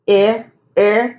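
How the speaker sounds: eh, eh as two separate short vowels; short vowel sound. Shown as a demonstration. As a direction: sound out the short "eh" twice, spaced apart. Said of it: "is making the short sound".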